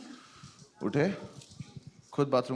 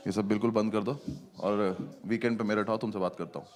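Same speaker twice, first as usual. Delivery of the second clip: lightly voiced stern uncle; 6 semitones lower, 4 dB quieter than usual. Uncle's stern calm advice.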